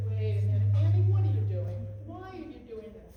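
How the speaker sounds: loud, urgent talking-to of oneself; self-questioning. Retold or imitated. Woman scolding herself at the door, urgent self-questioning. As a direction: stop yourself short, loudly, then ease off. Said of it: "I said".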